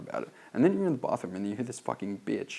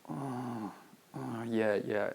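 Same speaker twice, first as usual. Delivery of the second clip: mock sympathetic listeners; 3 semitones higher, 4 dB quieter, much slower than usual. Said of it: "they're going like this".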